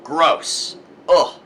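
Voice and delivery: mock-disgusted prude reacting. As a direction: sneer like a judgmental prude. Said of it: "viewed as a disgrace".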